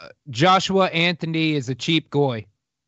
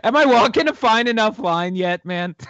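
laughing tease; 5 semitones higher than usual. Real, not acted. Cheeky self-aware joke, laughing.

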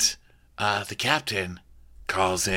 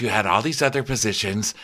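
normal talking voice

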